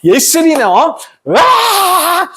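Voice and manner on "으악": long, high acted yell; hammed-up drawn-out scream; mock tantrum scream. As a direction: draw out a high-pitched tantrum shriek. Acts out a child's outburst.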